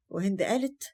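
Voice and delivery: ordinary delivery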